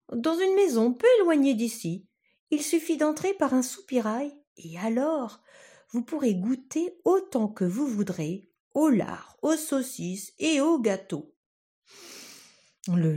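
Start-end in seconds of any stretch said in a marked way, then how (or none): 0.1-11.3 put-on a tiny boy flattering a wolf; coaxing little Tom. Tom sweet-talks the wolf inside its belly, coaxing high voice.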